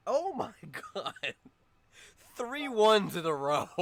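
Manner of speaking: loud, high astonished outcry; laughing disbelief. Real, not acted. Game master seeing three ones rolled, loud laughing disbelief.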